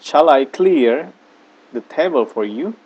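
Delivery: Shall I clear the table for you said slowly and carefully; slow model sentence. Shown as a demonstration. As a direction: spell it out slowly for the class. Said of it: "teacher models the English sentence". (ucapkan pelan dan jelas untuk murid)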